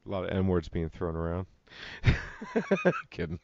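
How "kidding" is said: ordinary talk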